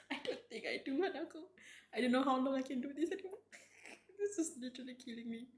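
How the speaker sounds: rushed, high words broken by laughter; overwhelmed laughing. Real, not acted. Streamer telling Hanako they can't cope, helplessly laughing. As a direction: laugh helplessly as you race through it.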